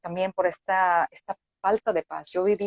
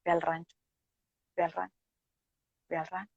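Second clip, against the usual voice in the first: softer and softer inner voice, fading almost to nothing; a quiet inner call repeating, each time fainter; voz interior; 10 dB quieter, faster than usual. Retold or imitated. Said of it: "venía cuestionando interiormente". (voz interior cada vez más suave)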